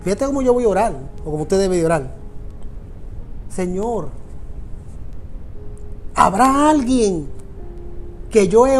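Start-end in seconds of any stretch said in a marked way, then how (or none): none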